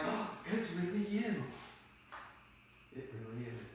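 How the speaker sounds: soft, hushed Juliet; relieved recognition. Retold or imitated. Juliet recognises Romeo in the dark, softly hushed. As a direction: drop to a hushed realisation. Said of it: "instead of being angry and instead of being freaked out".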